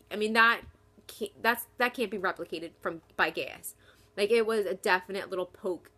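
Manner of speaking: ordinary delivery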